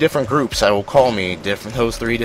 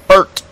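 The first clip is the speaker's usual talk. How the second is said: Bert barked out higher and louder; Bert stressed; 10 semitones higher, 9 dB louder than usual. Shown as a demonstration. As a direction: punch the single word Bert hard. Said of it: "it's that second syllable".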